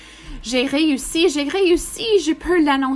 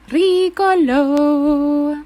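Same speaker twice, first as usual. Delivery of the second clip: funny voice; 4 semitones higher, 5 dB louder, much slower than usual. Acted. Reading emotion word, funny.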